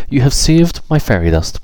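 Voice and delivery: high, thrilled thanks; grateful fairy delighted. Acted. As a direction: gush with relief.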